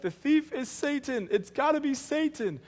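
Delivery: high, slow, sing-song mock certainty; mock naive believer. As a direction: parody the crowd's easy answer, pitched well up. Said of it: "We're like".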